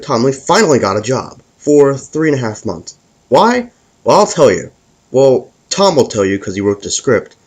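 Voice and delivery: ordinary delivery, nothing marked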